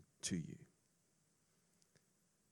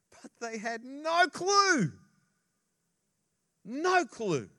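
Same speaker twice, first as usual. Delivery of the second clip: "no clue" higher, louder and slow; emphatic stress; 12 semitones higher, 9 dB louder, slower than usual. Real, not acted. Preacher telling the congregation the disciples had no clue, emphatic. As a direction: hammer home "no clue".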